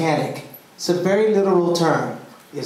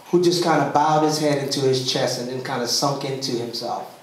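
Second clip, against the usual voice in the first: ordinary talk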